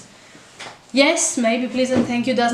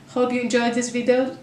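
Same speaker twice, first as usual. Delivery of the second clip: usual speaking voice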